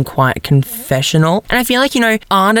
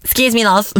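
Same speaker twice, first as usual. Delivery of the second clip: lightly imitated British accent, pitched up; a hint of mock British; 5 semitones higher than usual. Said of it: "he's, like, little British accent".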